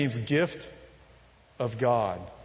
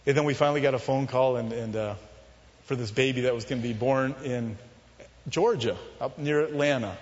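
ordinary talk, nothing marked

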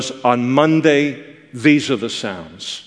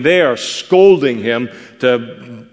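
ordinary talk, nothing marked

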